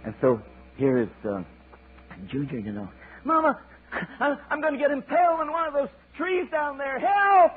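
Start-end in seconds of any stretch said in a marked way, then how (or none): 3.2-7.6 very high panicky eaglet, rising to a cry of help; baby bird calling for rescue; terrified child. Acted. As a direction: scream for mama while plummeting. Eaglet falling toward treetops.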